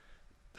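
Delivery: quick shocked intake of breath; sharp gasp. Retold or imitated. Realising it was drugs, a sharp gasp. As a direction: gasp as if caught off guard. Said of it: "I was like".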